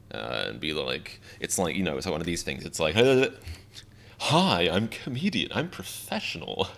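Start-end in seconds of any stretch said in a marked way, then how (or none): 3.0-6.7 high, stiff, awkward pro voice; cheesy mock professional intro; mock professional comedian. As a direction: put on a fake polished persona. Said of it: "It doesn't really work, but I try".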